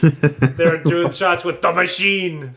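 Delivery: loud, drawn-out rowdy shout; mock drinking cheer. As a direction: holler it over shots. Retold drinking chant.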